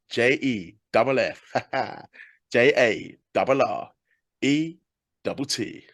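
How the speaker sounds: slow, spelt-out catchphrase chant with a laugh; Jeff Jarrett spelling. Retold or imitated. Host copying Jeff Jarrett's name-spelling catchphrase, slow and chanted. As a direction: spell it out letter by letter like a wrestler's catchphrase. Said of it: "do a Jeff Jarrett thing".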